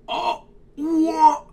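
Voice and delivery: high loud startled yelp; sudden shocked outburst; shocked cry. Real, not acted. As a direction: cry out in disbelief. Shock at the new schedule.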